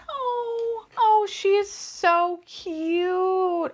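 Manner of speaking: high, slow doting coo. Real, not acted. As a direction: melt over the tiny figure. Cooing at new figure.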